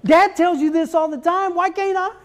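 high mock protest; imitated pleading mom. Wife defending her joke to crying son, high protest. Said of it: "she says".

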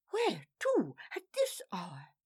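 hushed, unvoiced gossip; whispered question. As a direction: whisper it conspiratorially. Gossip in crowd.

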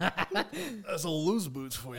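giggling punchline; laughing. Real, not acted. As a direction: laugh it out slowly.

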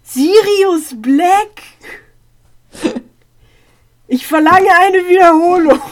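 loud, high mock-outraged demand; indignant protest. Real, not acted. Listener rejecting the quiz result, indignant outcry.

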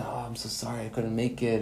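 a small, guilty voice hoping to be let off; sheepish mumbled apology. Acted. Poor man's sheepish excuse.

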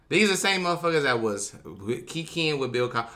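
ordinary delivery

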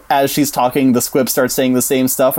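ordinary talk, nothing marked

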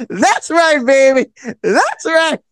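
very high, hyped-up affirmation; high-pitched, whooping encouragement; excited cheering. Retold or imitated. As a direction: imitate a lady cheering someone on. Mimics the lady's excited cheering.

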